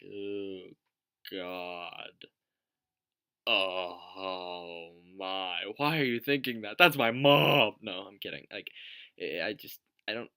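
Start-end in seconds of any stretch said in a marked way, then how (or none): 0.0-5.6 mock dismay. Acting out hearing others' thoughts. 5.8-7.7 loud, rising mock protest; mock outrage. Vlogger protesting at an imagined man's thoughts, mock outrage.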